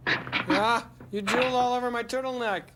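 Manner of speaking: high, loud complaint; mock disgust. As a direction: cry out in hammed-up revulsion. Mock disgust voiced for character.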